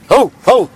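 high, loud mock bark; dog barking. Cat becomes a dog.

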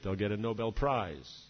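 normal talking voice

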